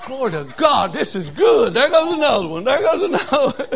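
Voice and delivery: put-on young man cheering in the pews; excited laughing praise. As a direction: cheer each one on with a laugh. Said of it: "I'm sitting there going".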